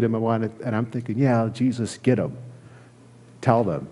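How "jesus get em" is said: ordinary talk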